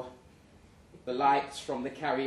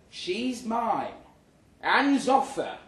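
firm claim; 7 semitones higher, 5 dB louder, slower than usual. Retold or imitated. Claiming the woman.

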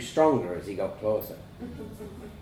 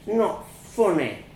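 put-on small child's wounded whimper; 5 semitones higher than usual. A narrator's reading. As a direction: protest weakly, whimpering. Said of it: "I whimpered".

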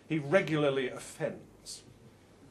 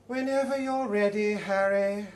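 higher, louder, pointedly patient; Yvonne's voice; 5 semitones higher, 5 dB louder than usual. Acted. Yvonne prodding Harry to play, pointedly patient. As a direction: needle him to hurry up. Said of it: "says Yvonne".

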